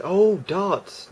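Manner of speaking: high, drawn-out penny-drop reaction; imitating dad's surprised understanding; dawning realisation. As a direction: let the misunderstanding suddenly clear. Dad finally understands the word.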